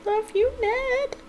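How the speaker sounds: high, slow, drawn-out coo; sugary high-pitched sweetheart voice; gushing coo. Pretend. Coos at the game's love confession.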